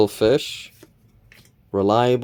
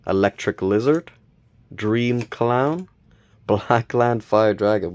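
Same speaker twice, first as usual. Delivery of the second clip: normal talking voice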